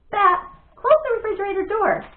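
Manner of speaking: sister yells across the kitchen; yelled. Acted. Sister yells at her brother at the fridge, a loud call. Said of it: "yelled his sister Janie".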